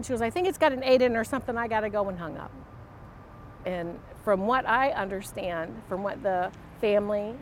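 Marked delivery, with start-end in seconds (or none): 0.2-2.1 fast, hurried retold answer; rushed brush-off. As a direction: rattle it off to get off the phone. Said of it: "that quickness".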